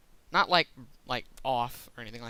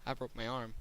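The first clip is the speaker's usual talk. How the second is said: calm, unbothered; 3 semitones lower, 9 dB quieter than usual. Retold or imitated. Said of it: "Didn't even raise his voice".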